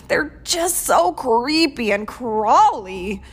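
slow, loud storybook wail. A narrator's reading. Giant dinosaur scared of spiders, wailing. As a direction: wail in hammed-up disgust and fear. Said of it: "wailed the Gigantosaurus".

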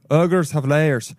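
slow, attempted Irish-sounding ogre accent; Shrek. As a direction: try an uncertain Irish lilt for the ogre, drawn out.

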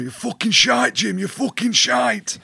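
high, loud mock rant in a put-on accent; Owen Farrell's accent. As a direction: yell the insult in his accent, stretched out at the end. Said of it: "And his accent going".

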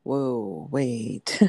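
low, wary double take; mock-startled low caution; taken aback. Retells seeing husband get emotional.

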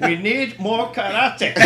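high, loud, slow laughing line. Real, not acted. Mocking the movie's flip scene, laughing loudly. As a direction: laugh the joke out.

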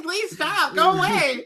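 high, laughing please stop; laughing mock plea. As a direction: beg through laughter. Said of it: "I'm like".

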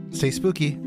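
ordinary delivery

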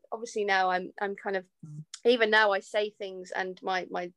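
usual speaking voice

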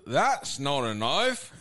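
slow, drawn Aussie "That's not a knife"; slow Australian accent; mock Australian. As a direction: Crocodile Dundee-style tease. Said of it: "don't even do the accent".

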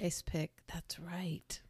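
softly whispered agreement; hushed aside, caught up in the story. Real, not acted. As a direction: breathe it, half to yourself. Listener reacts to ice pick clue.

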